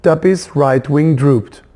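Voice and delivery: plain reading voice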